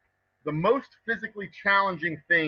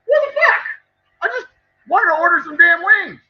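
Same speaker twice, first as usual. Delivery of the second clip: loud, much higher, disbelieving fuck; exasperated outburst; 12 semitones higher, 9 dB louder than usual. Retold or imitated. Frustrated customer left waiting, shouting in disbelief. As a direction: blow up at the restaurant that ignored you. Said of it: "And I was like".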